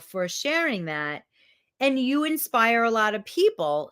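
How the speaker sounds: ordinary delivery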